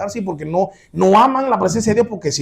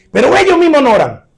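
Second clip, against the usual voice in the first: loud, high emphatic punch; raised-voice rebuke; 9 semitones higher, 10 dB louder than usual. Real, not acted. Preacher exposing those who beg prayers but never pray, loud rebuke. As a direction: drive it home at full voice.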